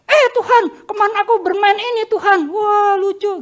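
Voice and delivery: put-on high, excited childlike calling out; high, eager child's prayer. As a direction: call out to God like a thrilled little boy. Child shouting prayer in church.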